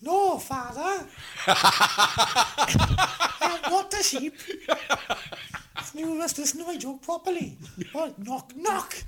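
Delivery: put-on very high, slow child voice; little girl voice. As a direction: squeak it out like a small daughter. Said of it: "Do the voice".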